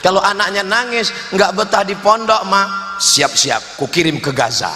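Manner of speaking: ordinary talk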